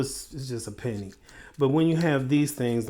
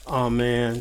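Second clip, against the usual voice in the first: normal talking voice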